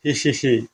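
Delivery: soft, acted hee-hee snicker; sneaky giggle. Havaldar plotting revenge on his boss, sneaky giggle. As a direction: snicker softly to yourself.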